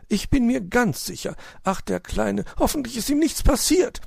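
high, fretting magpie voice; worried magpie. Acted. Frau Elster sorgt sich um Weißohr, hohe besorgte Stimme. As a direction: fret aloud for the little one.

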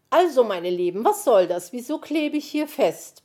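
loud, indignant protest; complaining. A narrator's reading. Magpie stuck to the glue, loudly complaining to friends. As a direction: complain loudly, rising at the question. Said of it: "beschwerte sich die Elster".

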